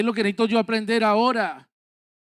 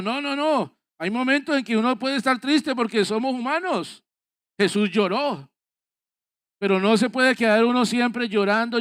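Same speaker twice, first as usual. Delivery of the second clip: raised, higher, urgent preaching; fervent pulpit exhortation to get up and go on; preaching at full force; 4 semitones higher than usual. Acted. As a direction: exhort the congregation, climbing with conviction.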